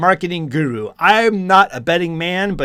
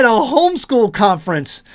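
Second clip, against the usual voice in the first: high-pitched, scandalized disbelief; hammed-up shocked exclamation; mock shock; 7 semitones higher, 4 dB louder than usual. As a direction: feign horror at the very idea. Mock shock after gasping.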